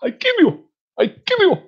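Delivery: very high, squeaky mock threat; comic terrorist impression. Amateur impressionist attempting a terrorist, high comic threat. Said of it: "I can't do a terrorist impression".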